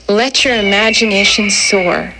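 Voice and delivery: plain reading voice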